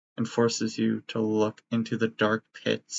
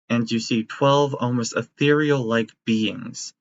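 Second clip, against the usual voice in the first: ordinary delivery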